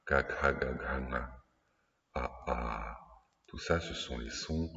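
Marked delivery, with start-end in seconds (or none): 0.1-0.6 K, K, K, K repeated as crisp throat clicks from the back of the mouth; demonstrated guttural K. Shown as a demonstration. Teacher showing students the guttural consonant K, crisp and deliberate. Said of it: "c'est K". 2.1-3.0 A, A sounded open and deep from the back of the throat; demonstrated guttural A. Shown as a demonstration. Teacher sounding the vowel A for students, deep and open. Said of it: "au niveau de A".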